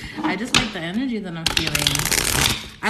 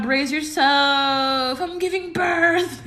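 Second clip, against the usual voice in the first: slow then laughing, higher; mock dramatic; 6 semitones higher, 3 dB louder, slower than usual. Real, not acted. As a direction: ham up the shock. Joking overwhelm.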